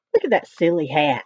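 usual speaking voice

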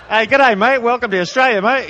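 loud, high Aussie greeting; hearty put-on Australian "g'day"; Australian accent. Australian official greeting arrivals at customs, mock Australian accent.

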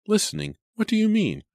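high-pitched, agitated disbelief; sharp, disbelieving demand; incredulous questioning. A narrator's reading. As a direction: throw it back at him, pitch soaring. Jenny questions him in disbelief.

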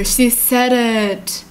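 slow, heartfelt sighing repeat; moved, overcome. Real, not acted. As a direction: let it sink in, stretching every word. Moved, slower repeat of the reaction.

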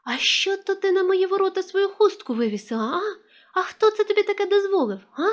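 high-pitched indignant scolding, held high to the end; outraged, high-voiced telling-off; sudden scolding yell. A narrator's reading. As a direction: scold like an angry granny yelling down the street. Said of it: "почала раптом репетувати на всю вулицю".

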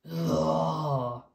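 drawn-out, mild lion roar; long storytime roar. Acted.

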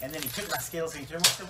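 usual speaking voice

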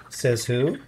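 plain reading voice

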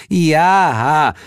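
high, drawn-out yes; bored singsong in a high voice; droning, put-upon yes. A narrator's reading. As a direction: drone it out, fed up. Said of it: "leierte Heel".

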